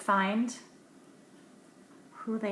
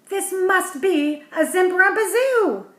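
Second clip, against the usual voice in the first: much higher, louder excited cry; 10 semitones higher, 12 dB louder than usual. Acted. Professor Warmbug feeling a tug on his fishing line, excited cry. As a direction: shout it out with delight. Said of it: "cried Professor Warmbug".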